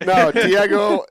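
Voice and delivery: loud high call out. Real, not acted. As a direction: call out to him. Apologetic call to friend.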